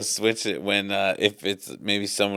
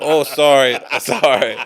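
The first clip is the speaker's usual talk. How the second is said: put-on Japanese accent; 5 semitones higher, 11 dB louder than usual. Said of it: "I start responding to them".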